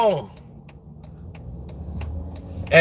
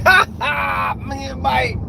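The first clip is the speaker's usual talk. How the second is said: mock laughing; 2 semitones higher, slower than usual. Telling how he'd laugh along with Mike. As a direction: fake buddy laugh. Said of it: "talking and laughing like".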